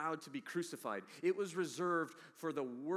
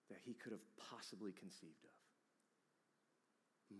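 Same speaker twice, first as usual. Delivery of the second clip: whisper; 8 semitones lower, 15 dB quieter, slower than usual. Real, not acted. Preacher hushes to a whisper.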